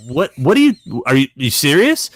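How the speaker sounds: high, loud stammering disbelief; floored. Retold or imitated. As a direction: replay stunned, tongue-tied astonishment. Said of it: "I was floored".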